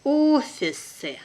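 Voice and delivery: slow and careful, drawn out for learners; teacher's careful slow reading. Shown as a demonstration. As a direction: spell it out slowly for the class. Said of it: "one first time will be slow".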